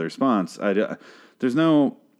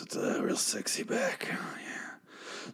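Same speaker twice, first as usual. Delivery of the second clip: hushed, slow, hammed-up leering whisper; mock sultry whisper; 13 dB quieter, much slower than usual. Podcaster ogling the lawyer's back, mock sultry whisper. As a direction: whisper it slowly, as if lusting.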